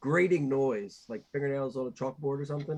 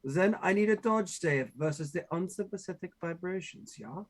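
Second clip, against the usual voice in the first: accidental mock-German accent; 2 semitones higher than usual. Player asking for a dodge save, German accent. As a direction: slip into a comic German accent. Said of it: "do that in a German accent".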